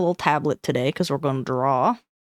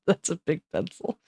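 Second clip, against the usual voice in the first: ordinary talk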